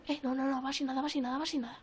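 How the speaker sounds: ordinary talk, nothing marked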